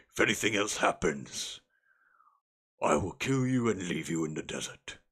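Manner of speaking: lightly voiced orc threat; a hint of a menacing captor. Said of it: "basically threaten them and say".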